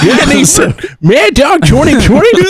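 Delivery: high mocking tease; teasing. Real, not acted. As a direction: needle him playfully. Teasing drunk friend.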